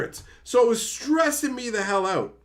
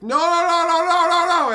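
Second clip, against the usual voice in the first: high, loud panicked pleading; frantic string of nos, pitched up and loud; 10 semitones higher, 7 dB louder than usual. Retold or imitated. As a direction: beg the phone not to stop ringing. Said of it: "And I'm like".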